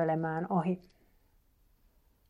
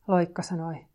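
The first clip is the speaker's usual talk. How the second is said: usual speaking voice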